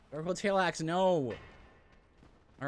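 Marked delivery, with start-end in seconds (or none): 0.1-1.3 high, louder frustrated shout; frustrated outcry. Real, not acted. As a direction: cry out in dismay. Dismayed at loot.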